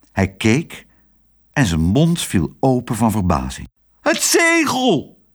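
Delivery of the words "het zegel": very high, drawn-out exclamation; stretched, high-pitched mock shout; astonished cry. A narrator's reading. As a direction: cry out in amazement, pitch right up. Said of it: "schreeuwde hij".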